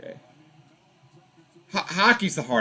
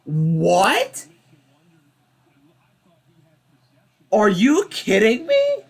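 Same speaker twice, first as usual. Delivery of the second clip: real outrage, high, louder and disbelieving; outraged disbelief; 6 semitones higher, 3 dB louder, slower than usual. Real, not acted. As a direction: shout it at the screen like the ref robbed you. Streamer outraged at a bad call.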